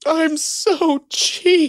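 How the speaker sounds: high, slow campy falsetto; hammed-up high voice; camp falsetto voice. Joking about being used cheaply.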